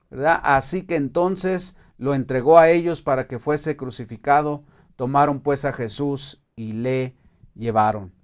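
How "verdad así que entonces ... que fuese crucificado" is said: ordinary talk, nothing marked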